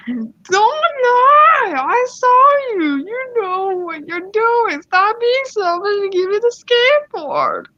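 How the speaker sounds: high, drawn-out mock outrage; entitled mom. Entitled mom accuses skater of lying, high wailing outrage. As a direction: scold in a hammed-up mother voice. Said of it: "here comes the voice actors".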